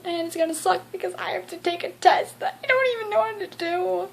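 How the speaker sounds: dreading a school test, a touch of real upset; getting emotional. Real, not acted. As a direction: let the dread creep into the voice. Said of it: "I'm getting all emotional".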